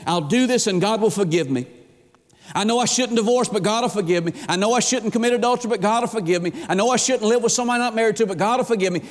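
quick, singsong excuses, each starting high; mock flippancy. Sinners excusing themselves because God will forgive, flippant imitation. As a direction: rattle off excuses, dropping into easy assurance. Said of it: "we live with this flippancy".

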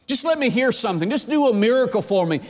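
high, urgent mock begging; coaxing plea. Herod trying to get Jesus to perform, imitated coaxing. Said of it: "tried his best just to get Jesus to say something".

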